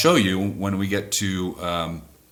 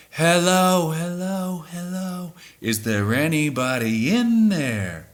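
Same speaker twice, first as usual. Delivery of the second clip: mimicked vocal delay; 8 semitones higher, slower than usual. Shown as a demonstration. Showing how fans copy the studio echo, fading sung hellos. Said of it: "They would go like this".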